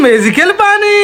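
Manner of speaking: ordinary talk, nothing marked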